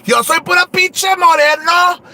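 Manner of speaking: high, proud shout. Acted. Shouting her own name proudly to the viewers.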